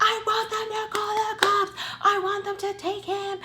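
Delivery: very high, strained mock yell; retold yelling. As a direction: act out a frantic screamed comeback. Retold yelling back about the cops.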